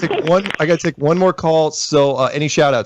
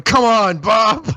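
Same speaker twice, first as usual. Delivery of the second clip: Steve Carell; 9 semitones higher, much slower than usual. Retold or imitated. Host quoting the sitcom general pleading with the senator, higher exasperated plea. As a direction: whine it up high in exasperation. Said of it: "Steve Carell's going".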